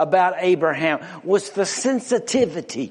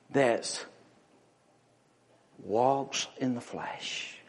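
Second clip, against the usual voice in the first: much softer and slow; hushed; 5 semitones lower, 10 dB quieter, slower than usual. Real, not acted. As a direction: drop it low for weight. Preacher contrasts spirit and flesh.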